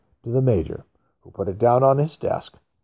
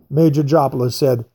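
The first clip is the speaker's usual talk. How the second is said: ordinary delivery, nothing marked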